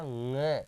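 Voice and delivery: ng as one long nga through the nose; nasal pronunciation. Shown as a demonstration. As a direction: sound out ng from the nose, held. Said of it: "इसका उच्चारण कहाँ से होता है". (ng को नाक से खींचकर "ङ" बोलें)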